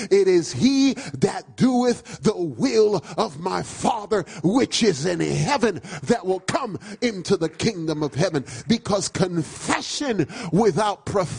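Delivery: driving, emphatic sermon delivery; full-force preaching. Real, not acted. Preacher telling the church what the Bible says, full-force preaching. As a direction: hammer each phrase home to the congregation.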